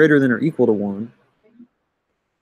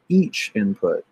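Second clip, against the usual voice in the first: "each" raised and drawn out, "input" dropping; stress on EACH; 3 semitones lower, slower than usual. Shown as a demonstration. Teacher insisting on the correct word, stress on "each". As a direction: hit "each" slowly, let "input" fall. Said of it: "puts the emphasis on the right syllable".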